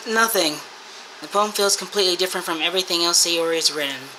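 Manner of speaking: normal talking voice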